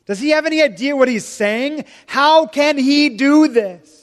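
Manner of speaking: an offended listener protesting loudly; mock outrage. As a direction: drag it out in scandalized disbelief. Imagined outraged Orthodox listener.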